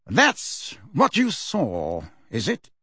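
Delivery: usual speaking voice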